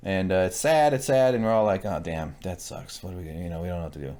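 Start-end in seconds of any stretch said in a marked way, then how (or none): 1.9-4.2 low, soft, hushed mock dismay; lightly voiced gloomy onlookers, quiet; mock despair. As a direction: mumble it like a glum crowd. Voicing the group's despair.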